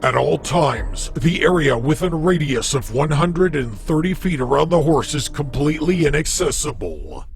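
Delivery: measured, formal reading of a creepy database file; documentary entry read. Acted. Reads a Backrooms database entry.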